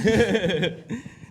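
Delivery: short burst of laughter; laughing at his own joke. Real, not acted.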